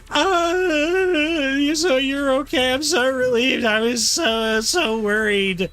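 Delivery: high, drawn-out relieved wail; hammed-up high worried voice; worried character in tearful relief.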